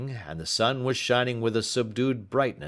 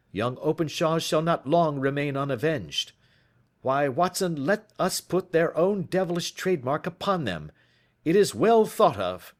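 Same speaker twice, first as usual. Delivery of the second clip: higher, grimly pleased detective; lightly voiced triumphant Holmes; 7 semitones higher, 4 dB louder than usual. A narrator's reading. Holmes tells Watson the gang will be avenged.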